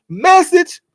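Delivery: high squeaky movie-quote voice. Retold or imitated. Streamer quoting the movie to chat, high squeaky. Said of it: "What they just say on Don't Be a Menace?".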